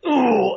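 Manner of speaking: high, loud mock "ooh" moan. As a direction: let out a hammed-up moan. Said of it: "it sounded like someone having sex".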